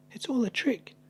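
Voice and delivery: very high, defiant little voice; high child voice. Acted. Gruffalo's child scoffing at the mouse story, high and squeaky. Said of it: "said the Gruffalo's child".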